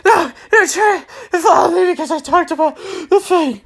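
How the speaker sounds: a hint of a jumpy villain; paranoid grunt. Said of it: "He was all like".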